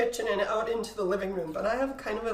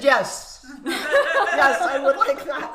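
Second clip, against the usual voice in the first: louder, laughing eager acceptance; eager yes; 2 semitones higher, 6 dB louder, much slower than usual. Retold or imitated. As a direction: blurt out yes happily. Her eager retold answer.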